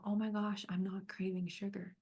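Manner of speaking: hushed, breathless amazement; imagined self whispering in wonder at breakfast; awed whisper. Pretend. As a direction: whisper it like a secret discovery.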